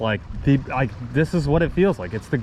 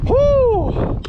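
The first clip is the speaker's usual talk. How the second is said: very high, loud cheer; real cry of celebration; excited whoop; 3 dB louder than usual. Real, not acted. Angler celebrating the day's catch, real high whoop.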